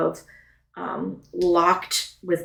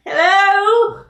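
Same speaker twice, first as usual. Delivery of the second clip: calling hello; 13 semitones higher, 5 dB louder than usual. Acted. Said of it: "And she's like".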